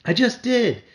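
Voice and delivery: sharp, high retort; a boy snapping back at his brother; shot back. A narrator's reading. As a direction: fire it straight back. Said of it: "Pete shot back".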